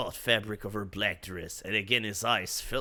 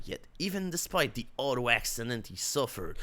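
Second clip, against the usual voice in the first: ordinary delivery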